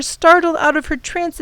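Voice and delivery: plain reading voice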